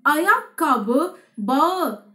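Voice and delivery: Ayakkabı bağı drawn out syllable by syllable; slow, clear pronunciation drill; slow teaching read. Shown as a demonstration. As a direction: say Ayakkabı bağı very slowly for learners to copy. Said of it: "ورباط الحذاء يدعى".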